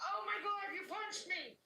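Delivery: high, shocked outburst; startled cry. Retold or imitated. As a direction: yelp it as if suddenly hit in the face. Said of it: "And I went".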